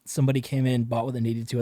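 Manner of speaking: ordinary talk, nothing marked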